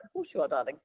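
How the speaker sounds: very soft, indulgent reply; hushed, placating aside; humouring. Retold or imitated. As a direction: gently humour them, voice dropped right down. Humouring the would-be Scot.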